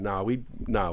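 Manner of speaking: ordinary talk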